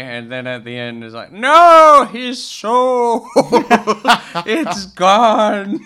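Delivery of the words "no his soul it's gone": much higher, louder wail broken by laughter; laughing mock drama. Host acting out the mother's doom line, laughing. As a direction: wail it with fake horror while laughing.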